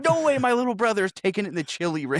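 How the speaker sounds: lightly hammed-up alarm; concerned older brother. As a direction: protest like an overprotective big brother. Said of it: "most concerned older brother on the fucking planet".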